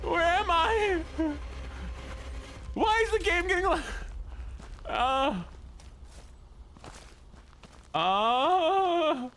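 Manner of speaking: high, frantic cries; high panicked voice. Acted. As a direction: squeal for help up high. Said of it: "apparently I need to do the whiny voice".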